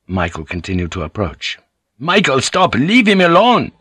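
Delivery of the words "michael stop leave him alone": high, loud, urgent order; commanding shout. A narrator's reading. Alvito orders Michael away from the knife, commanding shout. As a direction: order him off, pitch leaping up. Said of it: "Alvito commanded".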